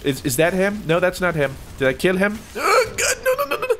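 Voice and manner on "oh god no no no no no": very high, whimpered alarm; panicked squeal. Real, not acted. Gamer cornered by a sword, high panicked whimper.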